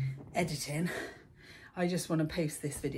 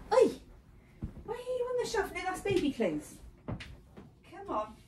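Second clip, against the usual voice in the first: very high, sing-song scolding of a cat; pet talk. Real, not acted.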